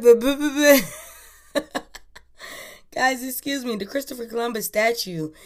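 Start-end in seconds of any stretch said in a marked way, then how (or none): 0.0-1.6 loud stuttering buh buh buh, higher; mock stammer. Tongue-tied host sputtering buh at her own slip. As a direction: sputter like you lost your words. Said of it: "I'm like".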